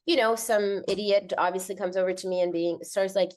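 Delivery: ordinary delivery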